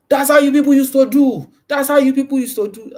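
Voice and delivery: hammed-up angry client talking down; imitating a rude client. Client talking down to the realtor, loud mock scolding. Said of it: "They talk down".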